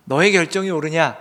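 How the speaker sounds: loud, emphatic question; strong, loud confrontation; forceful challenge. Acted. God contrasts Jonah's decision with his own.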